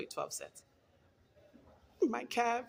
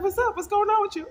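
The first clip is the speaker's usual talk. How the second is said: much higher and louder, bubbly greeting; mock trainer calling out a greeting; trainer's greeting; 10 dB louder, faster than usual. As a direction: call it out high and loud like a cheery trainer. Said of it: "She's like".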